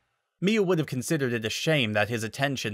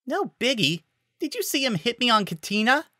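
much higher, disbelieving echo; incredulous protest; 12 semitones higher than usual. A narrator's reading. Miyu disbelieving Falco's shrug, much higher voice. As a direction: throw his words back in disbelief.

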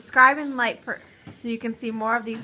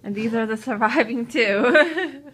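laughing; 7 dB louder than usual. Real, not acted. Laughing at pepper aftermath.

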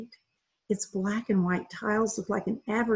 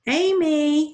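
loud, higher call across the house; 7 semitones higher, 8 dB louder than usual. A narrator's reading. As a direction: call your wife from the doorway. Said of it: "he called".

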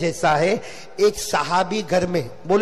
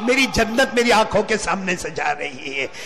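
subdued lament. Acted. Grieving son watching his paradise leave, subdued lament. Grieving line, dropped then repeated high.

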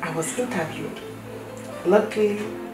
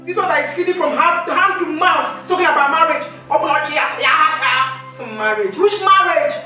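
loud, high furious tirade; furious shouting; 9 semitones higher, 8 dB louder than usual. Acted. Wife shouts down husband over the marriage threat, furious and loud. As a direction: blast him with scorn and rage.

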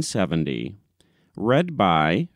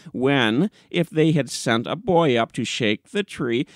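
higher, defensive and self-assured; cocky new editor talking back; indignant young editor; 5 semitones higher than usual. Acted. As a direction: insist the nonsense is good sense.

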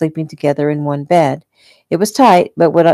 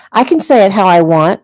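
put-on higher, louder child's protest; defiant arguing; 4 semitones higher, 6 dB louder than usual. A narrator's reading. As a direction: argue back, insisting on your way. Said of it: "her chin lifting defiantly".

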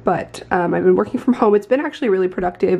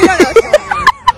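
shrieking laugh; 8 dB louder than usual. Real, not acted. Laughing at people watching eclipse.

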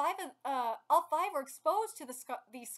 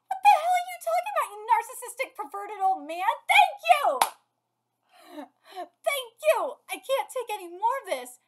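high, loud incredulous yelling; loud, high-pitched outraged outburst; exasperated rant; 7 semitones higher, 11 dB louder than usual. Real, not acted. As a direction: shout it, fed up with this ridiculous man. Exasperated rant at villain's speech.

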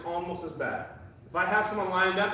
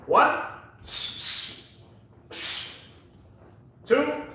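sharp, loud, high count calls; boxing coach calling combos; 9 semitones higher, 4 dB louder than usual. Real, not acted. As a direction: bark the numbers out to the class.

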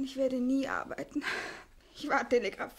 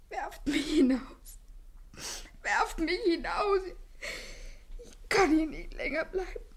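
desperate plea; 4 semitones higher, 3 dB louder than usual. Acted. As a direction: beg to be thrown out. Irina breaks down. (verzweifeltes Flehen)

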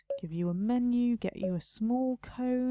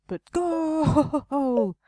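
long, loud, higher commentator "Goal!"; football commentator goal; 6 semitones higher, 7 dB louder than usual. Pretend. Celebrating a game score, a loud mock commentator yell. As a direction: belt it like a stadium announcer.

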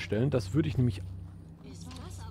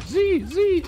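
shrill accusation; 6 dB louder than usual. Acted. Accusing who threw the stone.

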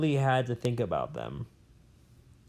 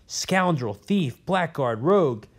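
rising, louder furious insults; an old professor raging at his enemy; furious shout; 5 semitones higher, 5 dB louder, slower than usual. Acted. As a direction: spit out each insult at a rival. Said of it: "shouted the old man".

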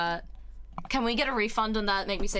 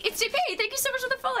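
excited squeal; 14 semitones higher, much faster than usual. Real, not acted. Thrilled by chat and follow.

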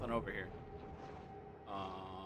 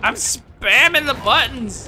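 frantic shout; 13 semitones higher, 14 dB louder than usual. Real, not acted. Frantic during the shark fight.

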